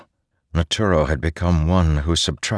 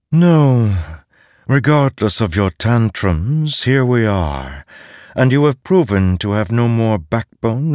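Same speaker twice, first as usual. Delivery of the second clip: haughty voice, higher and louder; disembodied voice; 5 semitones higher, 4 dB louder than usual. Acted. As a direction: talk down to him, contemptuous. The voice scolds Szeth.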